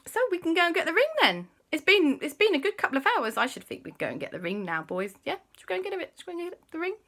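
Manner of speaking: high, fast, loud eager nagging; eager auntie. Acted. Auntie Lisa pressing the boys to fetch the ring, high and fast. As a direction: push to go get the ring now. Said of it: "she said".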